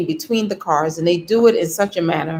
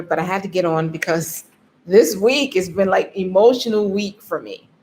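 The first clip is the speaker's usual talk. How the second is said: ordinary delivery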